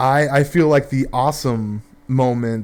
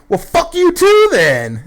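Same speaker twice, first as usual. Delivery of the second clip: very high, loud, slow screech; mock furious old lady; 7 dB louder than usual. Drunk lady snapping at the bartender, high loud mock screech. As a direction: shriek it like a drunk lady who got kicked out. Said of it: "And she goes".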